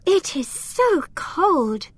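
high, slow little elf voice; a small elf shivering in the cold. Acted. Elf Lila shivering in her treehouse, high and slow. Character line in read story.

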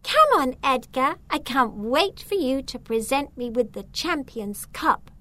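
high, teasing show-off voice; mischievous. A narrator's reading. Agrippina taunting Zelda by talking to Edgar, mischievously. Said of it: "So she mischievously said".

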